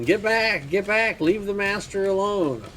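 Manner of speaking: high-pitched yell without full volume; frantic-style acted yell, pitched well up; mock yelling. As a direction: cry out high, warding creatures off your master. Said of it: "looking at these creatures and yelling".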